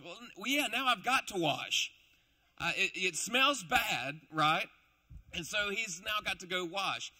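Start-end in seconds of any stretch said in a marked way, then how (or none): 0.0-4.7 blind man whining loudly; loud grumbling complaint. Acted. Blind man complaining to Jesus about the mud, loud and whiny.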